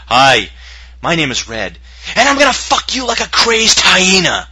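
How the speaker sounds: polite opener exploding into a yell; bold statement. Acted. Host pitching prospects at a sales meeting, mock-bold shout. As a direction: greet brightly, then shriek the threat high and loud. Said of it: "here's a good bold statement".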